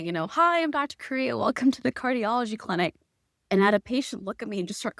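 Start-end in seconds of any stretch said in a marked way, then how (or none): 0.3-3.0 bright, higher doctor's welcome with a laugh; cheery greeting. Retold or imitated. Doctor recalls greeting a new patient, chirpy and upbeat. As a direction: sing out a breezy clinic hello. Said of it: "I used to say".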